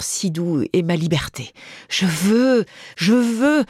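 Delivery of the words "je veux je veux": rising, louder insistent repeats; building insistence. A narrator's reading. Mistress insists, building louder and higher. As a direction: climb from firm to pleading high.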